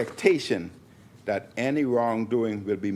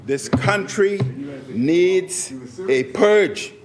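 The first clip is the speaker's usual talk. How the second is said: loud, higher and slow, hammering "this country needs a purge"; forceful repeat; 6 semitones higher, 4 dB louder, much slower than usual. Real, not acted. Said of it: "Let me repeat".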